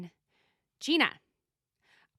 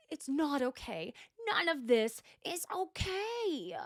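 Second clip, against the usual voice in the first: slow, drawn-out mock despair; mock meltdown; 3 semitones higher, slower than usual. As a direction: drag it out, climbing high into overwrought distress. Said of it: "having a full-on mother effing meltdown".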